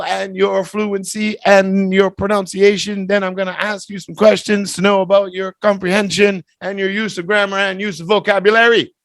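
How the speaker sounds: bright classroom instructions; test instructions. Acted. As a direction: run through the drill like a cheerful examiner. Teacher reads test instructions.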